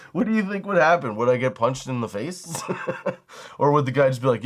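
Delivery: ordinary talk, nothing marked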